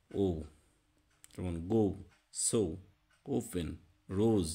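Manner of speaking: গো সো রোজ with a long o; রোজ like rose; English long o. Shown as a demonstration. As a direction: stretch the o in গো. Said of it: "ওর উচ্চারণটা হবে".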